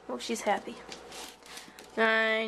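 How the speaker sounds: ordinary talk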